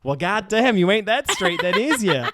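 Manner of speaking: high, loud Southern twang, breaking into laughter; hammed-up Southern punchline; Joe Exotic. Joe delivering his verdict to Travis, loud laughing Southern drawl. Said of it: "your Joe Exotic, uh, impression".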